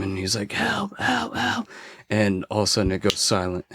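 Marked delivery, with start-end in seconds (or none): 0.5-1.7 very high, softened mock cries; imitated scream for help. Storyteller voices his attacked professor's cries, high soft mock screaming. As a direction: mimic a far-off scream, high but held back. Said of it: "you just hear him scream".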